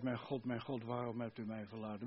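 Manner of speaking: soft, low quoted lament; subdued cry. Retold or imitated. Preacher quotes Jesus forsaken on the cross, subdued cry. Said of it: "Toen riep hij uit".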